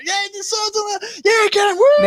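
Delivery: high, loud whooping fan; excited cheering. Retold or imitated. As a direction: cheer like you're ringside. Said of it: "I'd be sitting there going".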